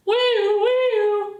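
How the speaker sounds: high mock siren wail; siren noise. Vlogger mocking an alarm, high siren wail. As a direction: sing out a silly alarm siren.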